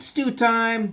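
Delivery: high, loud call to dinner. A narrator's reading. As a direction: holler it across the camp. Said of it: "When Papa cried".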